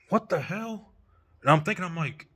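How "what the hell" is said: high-pitched, incredulous reaction; retold disbelief. Recalling his reaction to a neighbor's evil glare, incredulous. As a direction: replay your baffled thought, pitched well up.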